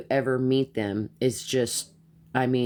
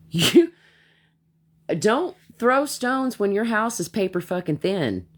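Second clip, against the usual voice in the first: mocking scorn; 7 semitones higher than usual. Real, not acted. Mocking the messy woman.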